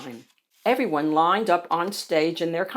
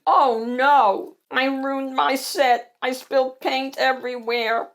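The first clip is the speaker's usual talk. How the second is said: dismayed yelp; 5 semitones higher, 3 dB louder than usual. A narrator's reading. Seymour after ruining the stage set, dismayed yelp. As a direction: yelp in comic distress. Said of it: "yelped Seymour Swiggletooth".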